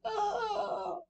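long high-pitched shriek; high scream. Acted. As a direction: cry out high in the dark. Second cry in the dark.

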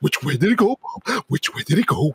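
a light cartoon character voice; Looney Tunes voice. Teasing his cohost, a light cartoon voice. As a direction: toss off a cartoon line. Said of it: "You're sounding like that Looney Tune guy".

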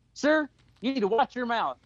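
mock stern reprimand, not shouted; retold scolding. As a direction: act out a first warning. Woman scolds man at game.

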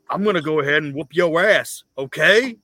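loud tough-guy promo threat; wrestling promo. Retold or imitated. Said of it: "he'll cut a promo, and he'll say".

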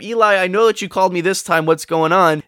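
put-on a boy woken the third time, sure now; insistent boy.

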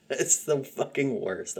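chuckling as you slam the accent; laughing. Real, not acted. Podcaster laughing while mocking country accents. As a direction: laugh through it, drawn out and amused.

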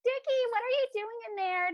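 high, mock-girly call through the door; high-pitched imitation of tipsy girls calling; squeaky party girls yelling through a door; mocking drunk girls. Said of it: "Girls are like".

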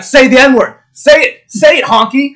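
high, loud badgering shout; bully him into it at full volume; Mike Tyson. Podcaster imitating the boxer shoving his guest, high shouted demand. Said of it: "pushing and shoving Norm Macdonald".

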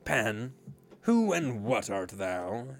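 hoarse, slow desperate question; husky cry. Acted. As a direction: cry out huskily in dread. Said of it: "he cried huskily".

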